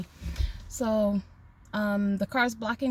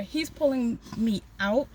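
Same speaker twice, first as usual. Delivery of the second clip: normal talking voice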